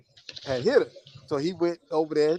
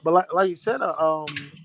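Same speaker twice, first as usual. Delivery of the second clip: ordinary talk, nothing marked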